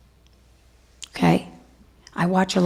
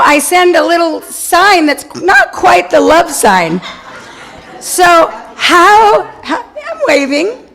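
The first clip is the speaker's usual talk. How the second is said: laughing while miming; 7 semitones higher, 6 dB louder, slower than usual. Real, not acted. Mimics rude sign, laughing.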